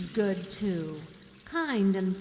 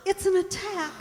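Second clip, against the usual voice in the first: high, loud alarm; alarmed cry; 10 semitones higher, 6 dB louder than usual. Acted. Helen seeing the mother's attack, high and alarmed.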